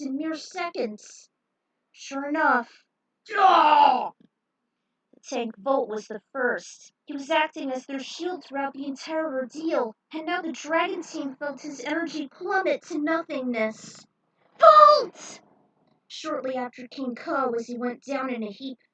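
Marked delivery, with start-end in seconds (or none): 3.4-4.1 loud startled cry; a short yelled ah; sudden shout. Acted. Narrator bursting out as the first bouncer falls, shouting. Narrator yells mid-battle. 14.6-15.4 very high, very loud cry of a name; anguished shout. Acted. Teammate screaming for fallen Volt, anguished shout.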